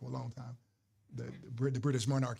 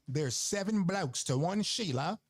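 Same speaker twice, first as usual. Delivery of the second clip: slow, higher-pitched Aussie cabbie; drawn-out Australian cab driver impression; Australian accent; 4 semitones higher, slower than usual. Quoting a Darwin cab driver, broad Australian accent. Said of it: "That's how he said it".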